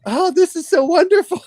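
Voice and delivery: very high, exaggerated delight; man won over by the food, very high and hammed-up; man's delight. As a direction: squeal with overjoyed surprise. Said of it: "And he's like".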